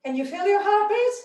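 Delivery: high, fast, energized question; excited, pumped. Real, not acted. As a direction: ask it buzzing from the workout. Breathless after jumping.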